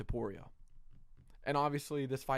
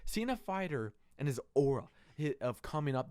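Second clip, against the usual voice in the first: ordinary delivery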